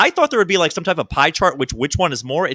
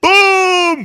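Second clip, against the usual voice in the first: big booming opener; loud shout; 7 dB louder than usual. Acted. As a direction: shout it to open the show. Podcast intro shout.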